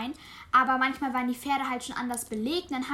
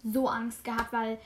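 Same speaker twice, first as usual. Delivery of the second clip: usual speaking voice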